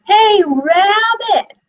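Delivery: high, loud, slow yell through the door; yelled call. Acted. Caller outside the door yelling for Rabbit, loud. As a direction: call out big and drawn out. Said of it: "someone yelled outside".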